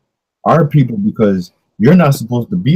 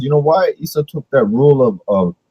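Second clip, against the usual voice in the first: ordinary talk, nothing marked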